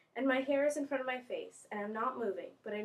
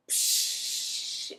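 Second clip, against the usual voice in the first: long unvoiced "pshhh" hiss; imitated water spray. Student mimics the hose going off, imitated spray. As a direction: hiss like a high-pressure hose.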